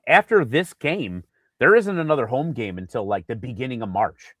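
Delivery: ordinary delivery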